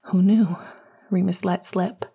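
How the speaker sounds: plain reading voice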